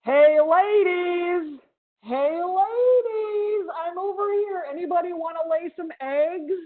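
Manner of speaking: loud, high, drawn-out call to the ladies; screaming male cicada. Acted. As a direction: holler like a cicada desperate for a mate. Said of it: "screaming really loud".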